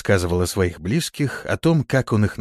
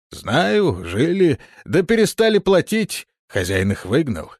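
high, loud grumpy watchman; gruff watchman; 8 semitones higher, 3 dB louder than usual. A narrator's reading. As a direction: voice a surly old guard. Watchman voiced by narrator.

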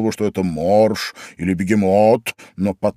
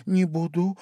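high, soft, small voice; submissive reply; 7 semitones higher, 6 dB quieter than usual. A narrator's reading. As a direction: give in meekly and softly. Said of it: "покорно ответила Алиса". (покорно, тихо и тоненько)

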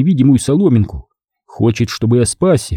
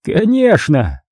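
high, drawn-out cardinal voice; gleeful cardinal confirmation; 7 semitones higher, much slower than usual. Acted. Cardinal confirming to his captive the execution, high and drawn-out. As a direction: stretch it slowly, pitched up, as the cardinal.

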